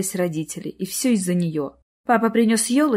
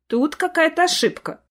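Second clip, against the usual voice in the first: higher-pitched puzzled mother; mildly flustered remark; uncertain mumble; 8 semitones higher than usual. A narrator's reading. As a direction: voice doubt, pitch lifted. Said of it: "пробормотала мама".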